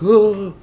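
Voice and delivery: high loud ugh of disgust; disgusted groan. Retold or imitated. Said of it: "they're all like".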